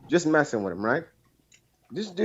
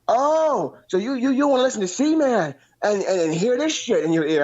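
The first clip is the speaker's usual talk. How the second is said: much higher mock rant at a buddy; imitating angry friend; 8 semitones higher than usual. Caller imitating his friend Bullfrog telling him off, high mock rant. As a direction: whine out his friend's furious brush-off, pitched way up.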